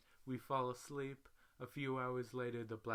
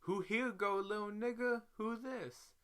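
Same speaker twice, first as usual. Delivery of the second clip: much higher and louder, confrontational; aggressive pimp; 12 semitones higher, 4 dB louder than usual. Retold or imitated. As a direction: challenge him hard and high. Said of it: "And Pimp says".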